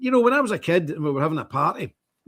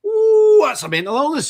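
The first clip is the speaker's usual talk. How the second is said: mock outraged critic; 10 semitones higher, 7 dB louder than usual. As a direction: imitate a scandalised commenter, starting on a big high whoop. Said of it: "the English person said".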